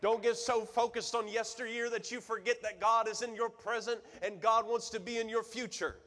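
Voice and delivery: high, loud preaching; high, loud preaching at full force. Acted. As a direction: preach it out to the whole room.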